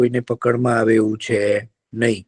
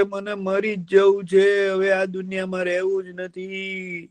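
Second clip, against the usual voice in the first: higher, slow mock-despairing fox; whining fox; 8 semitones higher, 3 dB quieter, slower than usual. Fox tells the deer he wants to die, whining. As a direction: moan like a fox faking misery.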